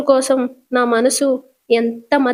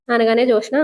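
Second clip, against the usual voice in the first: ordinary delivery, nothing marked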